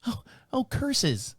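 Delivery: lightly voiced mild Ned Flanders dismay; a touch of a prudish neighbor's oh curses; prim Ned Flanders. Neighbor shocked by the scratch, mild prim Flanders dismay. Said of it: "a female Ned Flanders".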